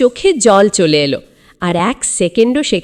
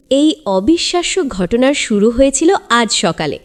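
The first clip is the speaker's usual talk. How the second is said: ordinary delivery, nothing marked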